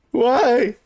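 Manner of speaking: high-pitched, plaintive cry; plaintive victim. Acted. Disheveled victim questioning the guard after the search, high and plaintive. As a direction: wail it high, hammed-up.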